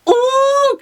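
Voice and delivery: very high, loud excited ooh; thrilled ooh. Retold or imitated. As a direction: squeal like a fan seeing his hero. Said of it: "I'm like".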